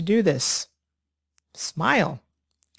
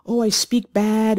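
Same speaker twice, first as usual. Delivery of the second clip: higher, louder mock apology; mock apologetic student; 6 semitones higher, 4 dB louder than usual. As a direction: imitate a sheepish learner apologizing. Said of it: "I don't like students saying".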